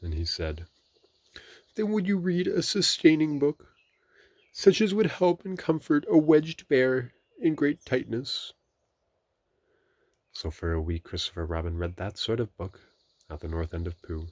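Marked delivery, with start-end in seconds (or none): 1.8-8.5 tearful. A narrator's reading. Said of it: "A tear rolled down his eye".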